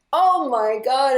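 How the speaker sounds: slow, loud, high disbelief; stunned oh my god. Retold or imitated. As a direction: let the shock land slowly and loud. Retold shock at meeting sister.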